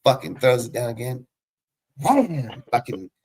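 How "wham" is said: high-pitched punchy wham; sudden sound-effect wham; imitated impact noise. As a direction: voice the smash of a thrown pipe. Imitating the second throw.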